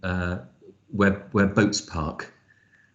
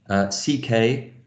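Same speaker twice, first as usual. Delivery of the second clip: normal talking voice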